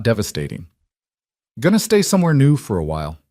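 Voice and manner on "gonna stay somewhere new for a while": forced brightness. Acted. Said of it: "Ethan forced brightness into his voice".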